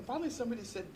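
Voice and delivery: ordinary talk, nothing marked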